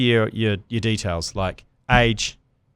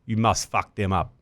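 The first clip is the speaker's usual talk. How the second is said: put-on South African accent; 2 semitones lower, 5 dB quieter than usual. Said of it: "your best South African accent".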